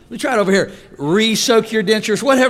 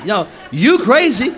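normal talking voice

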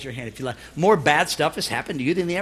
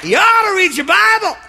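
preaching shout; 11 semitones higher, 8 dB louder than usual. Real, not acted. Preacher urging the congregation to read their Bible, shouting. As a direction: shout it much higher and louder.

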